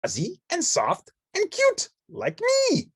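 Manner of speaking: high, bright-eyed puppet chatter; Ernie voice. Acted. Ernie showing friends the autumn woods, high and eager.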